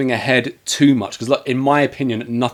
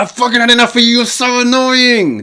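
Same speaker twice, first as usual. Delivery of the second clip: mock annoyed outburst; 12 semitones higher, 6 dB louder than usual. Boyfriend snapping at his girlfriend, mock annoyed outburst. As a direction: yell it like a fed-up, hammed-up boyfriend. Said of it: "Even he's annoyed".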